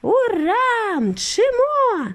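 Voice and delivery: high, drawn-out whoops; exhilarated high shout; cheering cry. A narrator's reading. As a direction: cheer high, holding the vowels. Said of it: "кричав дідунь Джо".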